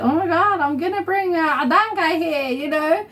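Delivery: ordinary delivery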